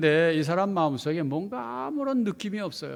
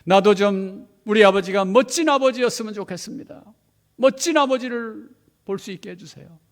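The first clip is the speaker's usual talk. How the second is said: tearful prayer; 4 semitones higher, 9 dB louder than usual. Retold or imitated. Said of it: "막 울면서 기도합니다".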